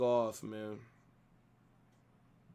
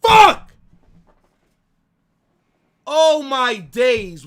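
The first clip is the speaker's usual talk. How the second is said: long goal yell; 7 semitones higher, 12 dB louder, much slower than usual. Real, not acted. Opponent scores, fan erupts.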